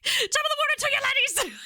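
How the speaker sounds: very high, hammed-up Irish greeting; Jacksepticeye impression. Mocking own Irish attempt with a YouTuber's greeting, squealed stage-Irish. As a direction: squeal a cartoonish Irish hello, far above normal. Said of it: "fucking thing of Jacksepticeye".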